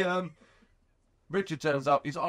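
usual speaking voice

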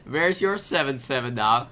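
high, loud triumphant gloat; gleeful gloating. Real, not acted. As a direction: crow over the combo. Gloating over winning combo.

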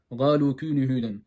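galu kûnü hûden, baştaki harf g, ünlüler ince; GALU'yu g ile, yavaşça oku; yanlış Türk okuyuşu. Retold or imitated. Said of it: "Bunu ga diye okuyorlar". (kaf yerine g'li telaffuz, galu)

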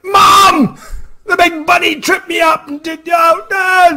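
put-on high, loud tattling child; whining kid. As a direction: wail to mom like a tattling child.

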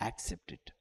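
hushed whisper. Real, not acted. Pastor urging the believer to accept God's will, whispered. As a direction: whisper it to them. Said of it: "దేవుడు అనుమతించిన దాన్ని నువ్వేం చేయాలి".

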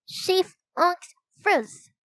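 slow, high garbled words through food; little kid talking with a stuffed mouth; mouth full. A narrator's reading. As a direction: muffle each word round a big mouthful. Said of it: "said with a full mouth".

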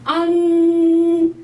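안 stretched high; slow nasal 안. Shown as a demonstration. Teacher modelling 안 aloud. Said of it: "소리 내고".

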